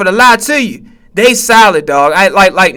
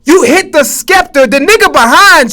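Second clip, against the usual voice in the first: exasperated; 5 semitones higher than usual. Real, not acted. Reacting to game fail.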